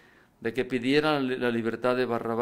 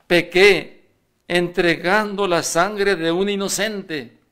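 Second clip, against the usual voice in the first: anguished confession; 6 semitones higher, 8 dB louder, slower than usual. Acted. Judas confiesa arrepentido.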